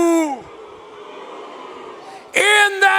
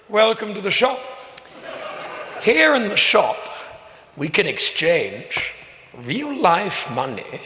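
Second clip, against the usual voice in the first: whispered asmr role play; 8 semitones lower, 3 dB quieter than usual. Acted. Host voices in-game shopkeeper.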